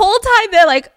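ordinary delivery

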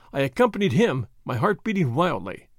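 ordinary delivery, nothing marked

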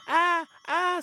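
high-pitched startled "ah, ah"; little forest spirit yelping; spirit's cry. A narrator's reading. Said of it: "said the voice".

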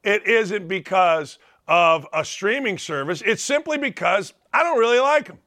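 ordinary talk, nothing marked

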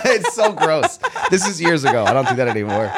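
loud, high-pitched laughing protest; laughing embarrassment. Real, not acted. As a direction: laugh through an embarrassed confession. Laughing at own embarrassing confession.

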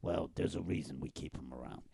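low, hushed mob talk; hushed mobster scheming. Acted. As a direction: keep it down, conspiring like gangsters.

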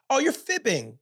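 Oh, you're fibbing said high and gentle. Shown as a demonstration. Parent calling out a child gently, soft and high. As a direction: tease lightly, sing-song soft. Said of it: "fibbing is a little softer".

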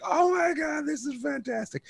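high, mock-thrilled squeal of joy; retold giddy delight. Laughing friend recalls loving the on-stage stunt, high excited squeal. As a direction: gush like the funniest thing ever is happening. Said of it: "It was just like".

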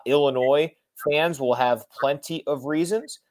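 usual speaking voice

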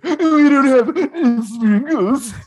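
imitating the ice cream guy's condescending refusal, high and slow; snooty refusal. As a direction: stretch it out like a smug server. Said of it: "the guy was like".

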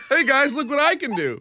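voicing a proud dog through laughter; laughing. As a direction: giggle out the dog's boast.

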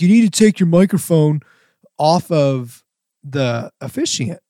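mild mock yell; retold yell. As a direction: imitate an angry shout at only part strength. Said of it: "he yelled it again".

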